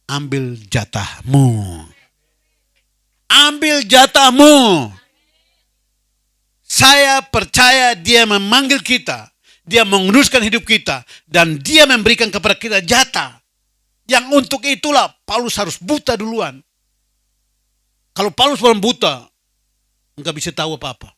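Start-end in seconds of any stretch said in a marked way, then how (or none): 0.1-1.9 slowly drawn out "Ambil jatahmu"; slow emphatic command. Real, not acted. 3.3-4.9 "Ambil jatahmu" slow, much louder and higher; shouted proclamation. Real, not acted. 6.7-19.2 loud, higher fervent preaching; full-force preaching. Real, not acted.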